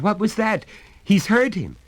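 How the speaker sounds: high loud shocked cry; frightened cry. Acted. Betty crying out at the scream upstairs, high and loud. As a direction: gasp it out in horror. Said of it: "she cried".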